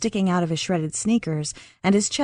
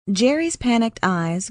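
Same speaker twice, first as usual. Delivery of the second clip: ordinary delivery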